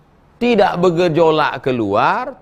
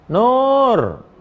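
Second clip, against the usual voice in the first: calling out; 7 semitones higher than usual. Retold or imitated. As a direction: call her from across the house. Mother calling her praying daughter.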